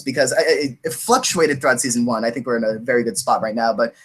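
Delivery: ordinary talk, nothing marked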